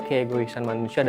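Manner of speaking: ordinary talk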